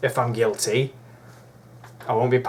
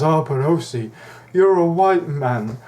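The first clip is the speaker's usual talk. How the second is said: higher, drawn-out mock whine; slow, louder mocking quote; mocking objector; 4 semitones higher, 4 dB louder, slower than usual. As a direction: imitate a complainer, stretching the words out. Mimicking an imagined objector's complaint.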